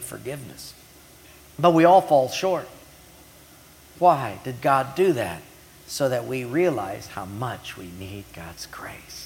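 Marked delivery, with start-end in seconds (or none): 5.9-9.2 low, soft, near-whispered preaching; quiet, earnest near-whisper; hushed emphasis. Real, not acted. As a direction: drop to a hush for the key point. Preacher drops voice for key point.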